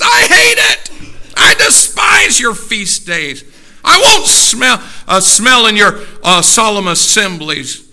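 high, very loud preacher's shout; furious pulpit roar; God's angry rebuke. Acted. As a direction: thunder it at the congregation in disgust.

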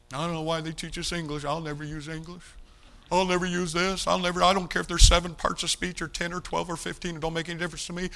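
mock a sulky fifteen-year-old griping about English class; whiny teenager. Preacher imitating his teenage self dismissing schoolwork, mild sulky whine. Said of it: "it sounded like Jerry Ross about fifteen years of age".

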